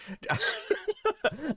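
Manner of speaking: sudden high breathy giggle; embarrassed little laugh. Real, not acted. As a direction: laugh at yourself. Laughs at own odd delivery.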